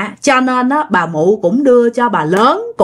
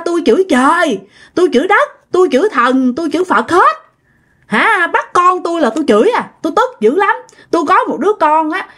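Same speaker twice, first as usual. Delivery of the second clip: higher-pitched ranting at heaven and Buddha; hammed-up furious tirade, higher; angry ranting; 5 semitones higher than usual. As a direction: rail at the gods, pitch held up high. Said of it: "Là ông cố nói vậy".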